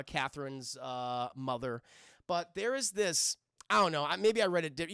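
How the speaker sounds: ordinary talk, nothing marked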